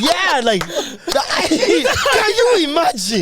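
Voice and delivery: laughing. Real, not acted. Laughing through the bit.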